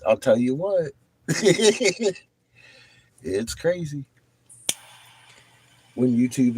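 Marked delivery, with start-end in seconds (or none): 1.3-2.1 high, quick cackle. Real, not acted. Streamer reacting to chat, cackling. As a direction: burst into a short high cackle. Laughs at viewer comment.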